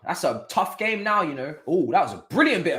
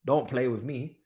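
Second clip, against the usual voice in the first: ordinary talk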